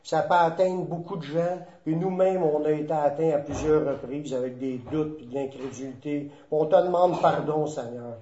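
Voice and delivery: low, softened praying voice; prayer. Real, not acted. Leads the closing prayer. (prière recueillie, voix plus basse et adoucie)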